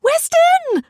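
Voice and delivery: high, loud squeal of a name, half laughing; playful squeal. Acted. Woman squealing at her lover in a cold shower. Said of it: "I squealed".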